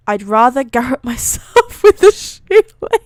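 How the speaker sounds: loud, high, through real laughter; laughing. Real, not acted. Reader amused by garrote line, laughing hard. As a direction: crack up and keep talking over it.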